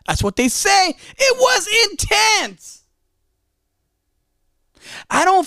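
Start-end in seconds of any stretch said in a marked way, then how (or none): 0.9-2.8 intense shout. Shown as a demonstration. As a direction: shout it out with full passion. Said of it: "Some people say I came out angry".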